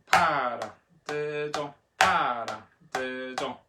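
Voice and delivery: "Para, de, do" with PA punched; accent on first syllable. Shown as a demonstration. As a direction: chant it, hitting the opening of "para". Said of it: "number one first".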